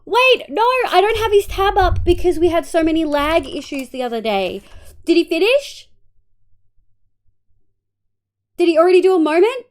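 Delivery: high, loud disbelieving questions; panicked realisation, voice jumping up; alarmed. Real, not acted. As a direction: blurt it out in rising alarm. Discovers she may have missed it.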